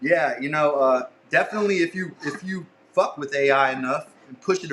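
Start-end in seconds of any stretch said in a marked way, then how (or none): none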